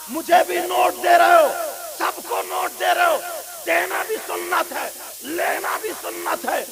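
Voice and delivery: roaring sermon, high and very loud; full-force shouted sermon; fiery preaching. Acted. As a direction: thunder it at the congregation.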